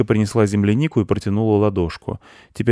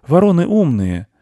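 put-on high, loud boy's voice; high-pitched young boy asserting; little boy; 10 semitones higher, 7 dB louder than usual. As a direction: state it in a high child voice. Boy praises crows.

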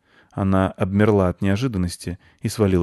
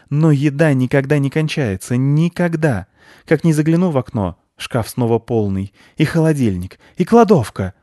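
high, louder excited squirrel; a pushy, suspicious squirrel insisting; 7 semitones higher, 6 dB louder than usual. Acted. Squirrel accuses the children of hiding the nut.

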